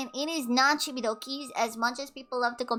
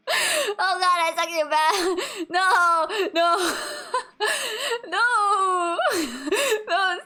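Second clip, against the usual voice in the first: high-pitched helpless laughter; laughing so hard it almost breaks; 5 semitones higher, 3 dB louder, slower than usual. Real, not acted. Laughing fit at Chad's joke.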